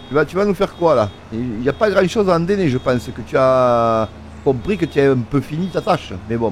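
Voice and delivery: normal talking voice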